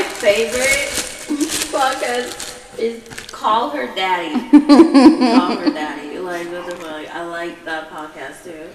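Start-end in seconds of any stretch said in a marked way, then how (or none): 4.5-6.2 loud laughing repeat, drawn out. Real, not acted. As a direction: blurt it out through a laugh. Repeating name, laughing loudly.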